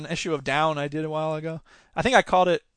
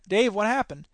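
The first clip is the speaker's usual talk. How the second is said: plain reading voice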